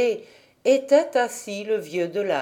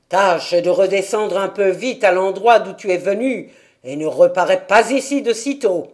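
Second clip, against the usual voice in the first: imperious order; 5 dB louder than usual. A narrator's reading. Old man orders Dete back down, louder and commanding. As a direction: order her off the mountain. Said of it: "dit d'un ton impératif".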